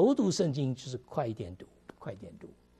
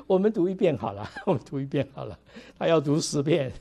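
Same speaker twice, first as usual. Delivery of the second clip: laughing through the words, higher and louder at first; 4 semitones higher, 6 dB louder than usual. Real, not acted. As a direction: chuckle while backing down to once. Joking about reading ten times. (笑着说)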